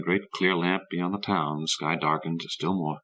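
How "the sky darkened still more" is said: ordinary delivery, nothing marked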